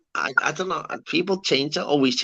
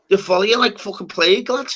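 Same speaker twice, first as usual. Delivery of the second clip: usual speaking voice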